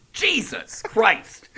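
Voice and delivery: high, very slow, drawn-out scolding; hammed-up parental exasperation; exasperated mom. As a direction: stretch it out like a fed-up mother.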